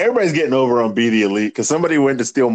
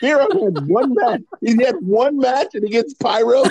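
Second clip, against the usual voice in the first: high indignant; 10 semitones higher than usual. Real, not acted. Indignant rant.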